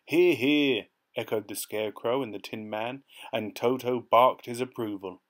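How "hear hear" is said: loud, raised cheer of agreement. A narrator's reading. Scarecrow and Tin Man backing Dorothy, cheering. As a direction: call it out in hearty support. Friends cheer the brave Lion.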